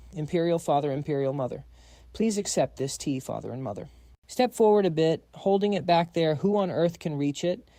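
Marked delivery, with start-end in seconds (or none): none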